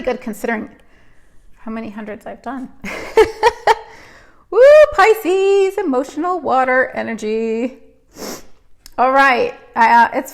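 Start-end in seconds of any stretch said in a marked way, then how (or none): 2.8-4.0 high bright burst of laughter; rising giggle, much higher and louder; embarrassed laugh. Real, not acted. Laughing at crying on stream. 4.5-7.8 high loud woo, half laughing; playful loud whoop, much higher; excited whoop. Real, not acted. Joking about emotional star sign.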